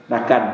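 loud Madurese-style "Nakan" instead of "makan". Retold or imitated. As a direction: say "Nakan" with an n where "makan" has an m, loud and flat. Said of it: "Dia Madura".

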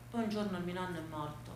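low, very soft, falling voice; quiet grief. A narrator's reading. Granddaughter tells of grandfather's death in the armchair, quiet grief. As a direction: say it barely aloud, sinking down.

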